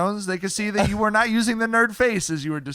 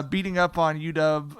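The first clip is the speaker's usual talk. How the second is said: ordinary talk, nothing marked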